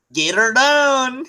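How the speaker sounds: slow, high Southern drawl on "Get her done"; drawn-out Southern catchphrase, pitched high; Southern accent. Pretend. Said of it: "how they would say it in the South".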